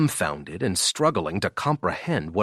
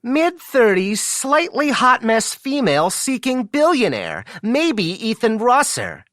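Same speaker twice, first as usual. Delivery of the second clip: mocking female voice, pitched way up; high-pitched squeak; 7 dB louder than usual. Friend imitates the employee reading her billionaire post, squeaky high-pitched voice. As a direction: squeak it out in a high, put-on woman's voice. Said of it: "really high-pitched voice, he says, squeaking".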